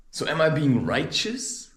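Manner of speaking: normal talking voice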